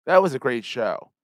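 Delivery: upbeat announcer read of the outro; podcast outro read. Acted. Host reading the podcast outro to listeners, announcer style. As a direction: read it like a polished show sign-off.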